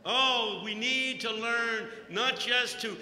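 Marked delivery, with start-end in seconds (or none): none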